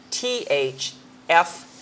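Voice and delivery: ordinary talk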